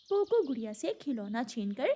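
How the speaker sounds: ordinary delivery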